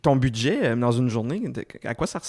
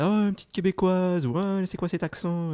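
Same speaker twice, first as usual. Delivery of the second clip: lightly acted, higher-pitched curiosity; mock surprised Parisians; 7 semitones higher than usual. Interviewer imitates Parisians noticing her Quebec accent, mock surprised. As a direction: imitate locals exclaiming, pitched up.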